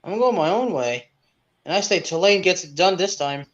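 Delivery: ordinary delivery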